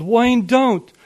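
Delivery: high, loud desperate plea; pleading yell. A narrator's reading. Mother begs father not to go upstairs, yelling. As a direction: yell it, begging him to stop. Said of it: "Mom yelled".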